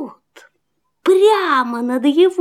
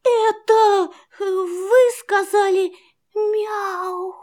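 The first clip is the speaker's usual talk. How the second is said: timid whisper; 5 semitones higher, slower than usual. Acted. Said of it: "прошептал щенок".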